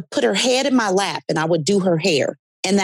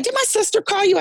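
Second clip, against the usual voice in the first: client's baffled question, pitched much higher; doubting client pushes back, much higher and incredulous; suspicious surprise; 13 semitones higher, faster than usual. Retold or imitated. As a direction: ask it as the puzzled, disbelieving client. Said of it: "She's like".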